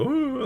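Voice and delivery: sudden high-pitched "ooh" squeak; voice tweaking. Shown as a demonstration. Host showing how his failing voice goes, high squeaky slip. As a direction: let the voice jump way up on an ooh. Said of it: "tweaking here every once in a while with my, uh, with my voice and go".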